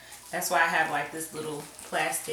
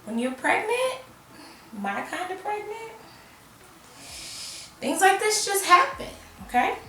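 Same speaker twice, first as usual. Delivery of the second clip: emphatic high pitch; 11 semitones higher than usual. Real, not acted. Emphatic aside about pregnancy.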